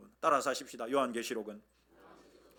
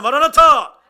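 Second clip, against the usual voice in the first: much louder, higher Maranatha; fervent shout; full-voice shout; 12 semitones higher, 20 dB louder, slower than usual. Shown as a demonstration. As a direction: shout it with everything. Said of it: "한 번 더 하십시다".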